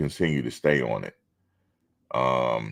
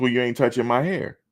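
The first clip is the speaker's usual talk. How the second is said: higher, pointed refusal; firm refusal; 9 semitones higher than usual. Retold or imitated. Refusing the lady who wanted to touch his hair, higher and pointed. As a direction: shut her down with attitude. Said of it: "I said".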